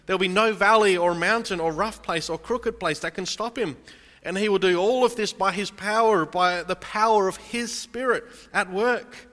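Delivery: impassioned sermon voice; full-force preaching. Real, not acted. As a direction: preach it out over the congregation. Preaching God's power.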